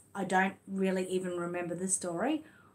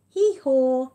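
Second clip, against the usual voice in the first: loud high hee-haw bray; donkey bray; 5 semitones higher, 10 dB louder than usual. Acted. Mum braying the donkey's hee-haw for her son, loud and high.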